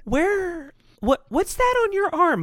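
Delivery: put-on high-pitched old lady questioning; mock old-lady voice, high and probing; disapproving old lady. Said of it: "it's the old ladies that will start shit".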